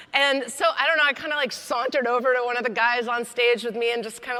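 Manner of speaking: normal talking voice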